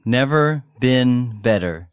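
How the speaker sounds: slow, careful teacher's model; slowly. Shown as a demonstration. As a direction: stretch each word for learners. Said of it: "take a look at the vocabulary".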